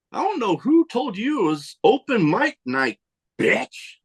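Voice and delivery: mock diva put-down; retold sassy put-down. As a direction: imitate a sassy callout, pitch swinging high. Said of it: "she was like".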